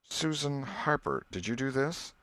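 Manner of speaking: put-on schoolmaster questioning a boy over the torn book; stern schoolmaster. Master questioning a girl.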